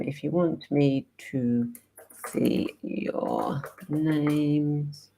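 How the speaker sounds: very slow, soft, stretched-out words; absent-minded typing aloud. Real, not acted. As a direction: drag each word softly as you type. Typing a message while talking.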